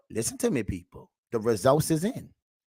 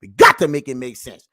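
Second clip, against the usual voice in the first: ordinary talk, nothing marked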